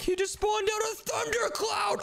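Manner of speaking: high, drawn-out exclamation; slow, high cry of shock; amazed alarm. Pretend. Player warning brother about monster's thundercloud, amazed high cry.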